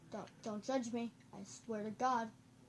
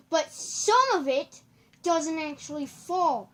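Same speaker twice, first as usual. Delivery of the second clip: higher, drawn-out shout; loud outcry; 5 semitones higher, 15 dB louder, slower than usual. Real, not acted. Gamer watching things fall, loud drawn-out complaint. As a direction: stretch it out at full volume.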